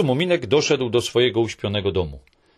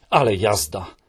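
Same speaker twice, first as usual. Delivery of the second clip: gleeful exclamation; 3 semitones lower than usual. Acted. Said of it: "zawołała Mi".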